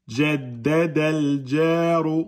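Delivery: jaddadal jaru slow, chanted and joined; linked reading. Shown as a demonstration. Teacher repeating the liaison for pupils, slow linked reading. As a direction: join jaddadal to jaru, long held vowels. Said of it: "on fait la liaison".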